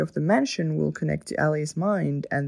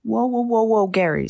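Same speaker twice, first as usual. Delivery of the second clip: loud, raised-pitch halting call; urgent interruption; 5 semitones higher, 6 dB louder than usual. Acted. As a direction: cut in to stop someone screaming. Cutting off Gary's screaming.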